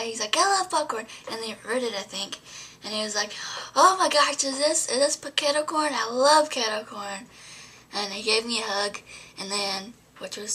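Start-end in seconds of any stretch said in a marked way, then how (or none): 0.4-1.1 high, loud imitation of him; delighted surprise. Imitating Jesse thrilled at the popcorn, high. 3.8-7.2 high, loud, stretched-out delight; thrilled gushing. Retold or imitated. Imitating Jesse gushing over kettle corn, loud and high.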